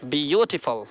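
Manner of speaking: Beautiful with यू drawn out; stress on BYU. Shown as a demonstration. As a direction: stretch the यू in beautiful, then fall away. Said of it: "आप ऐसा बोलेंगे".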